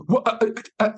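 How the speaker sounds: imitated stutter. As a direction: mimic someone stuck on a first word. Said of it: "he starts stuttering".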